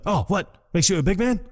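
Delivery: high-pitched, goading mock tirade; mock angry taunting. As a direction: taunt him like a partner losing it. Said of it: "tired of you yelling".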